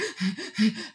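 mock hyperventilating; quick, panicky breaths in and out; imitated panic gasps. Storyteller reenacting panic before slithering into water, mock hyperventilating. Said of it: "a panic attack".

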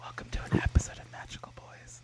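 breathy unvoiced whisper; ASMR whisper. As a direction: whisper close to the mic. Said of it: "a little A S M R".

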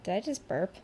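ordinary talk

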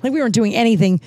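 normal talking voice

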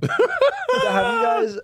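high, loud, drawn-out words through laughter; helpless real laugh into the question. Real, not acted. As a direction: try to ask while cracking up. Still laughing after the joke.

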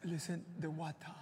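put-on soft, slow British accent meditation voice; a calm British guide, hushed; mock British-accented guide. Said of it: "imagínate te dice".

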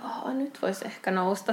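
slow, hushed morning murmur; sleepy whisper. Acted. Snoozer finally considering getting up, slow sleepy whisper. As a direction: whisper it as if barely awake. Acts waking slowly after snoozing.

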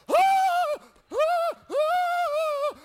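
repeated high piercing shrieks; loud, shrill-pitched yelps of pain; mock pained screams. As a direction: scream in bursts, in comic panic. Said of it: "I'm screaming out".

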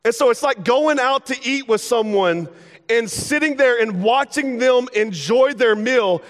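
normal talking voice

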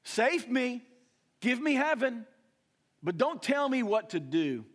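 high, loud whiny plea; mocking entitled believer. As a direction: ham up a spoiled believer demanding heaven. Mocking self-centred believer crying out.

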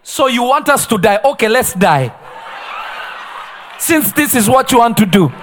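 laughing mock-defiant retort; sarcastic resignation. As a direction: throw it back at him, amused. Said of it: "Africans will be like".

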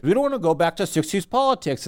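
Buttigieg impression. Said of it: "Buttigieg being like".

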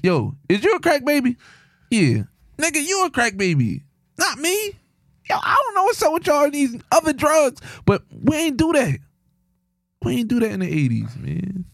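high, mocking back-and-forth; high mock protest. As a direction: play both sides of the argument up high. Mock interrogating and denying.

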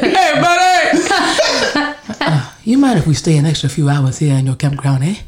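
imitating daddy's country accent, laughing, very slow start. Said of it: "that's exactly what my daddy said".

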